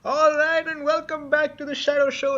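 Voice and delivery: high-pitched host's welcome back; show host returning. Acted. Host returns after sponsor read.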